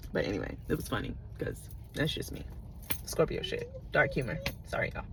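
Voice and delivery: whispered aside. Real, not acted. Admitting to viewers the crash story was funny, whispered aside. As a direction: let the confession slip out under your breath.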